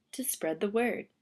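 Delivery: usual speaking voice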